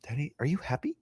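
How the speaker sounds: put-on soft whispered voice of a toddler asking sweetly; whispered little girl. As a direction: whisper it like a small innocent child. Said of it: "she's all".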